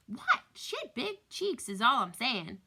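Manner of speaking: high, loud playful protest; squeaky indignant "who, me?"; mock innocence. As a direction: defend the joke with feigned innocence. Dad feigns innocence when scolded.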